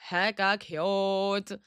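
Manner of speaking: HECKA CUTE stretched very slowly; slow, savoring repeat; drawn out. Pretend. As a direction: linger on each syllable of "Hecka cute". Drawn-out repeat of own phrase.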